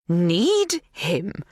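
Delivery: scornful echoed question; incredulous, scornful query; disdainful. A narrator's reading. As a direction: throw it back with disdain. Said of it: "gives a grunt of disdain".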